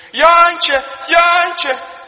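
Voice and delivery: long, high, very loud call; shouting out. Acted. Farmer's wife calling into dark woods for the boy, shouting out. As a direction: holler the name far into the dark. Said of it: "ze roept door de bos".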